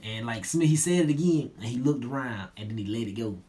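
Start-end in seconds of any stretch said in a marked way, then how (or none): none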